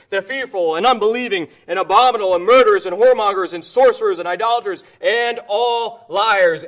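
loud, driving scripture reading; preacher pounding out scripture; fiery preaching. Acted. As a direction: thunder the verse at the congregation. Preaching scripture at full force.

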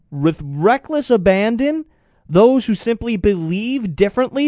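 high-pitched indignant challenge. Real, not acted. Radio host challenges the president over the deal, indignant. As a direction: demand answers, pitched high and rising.

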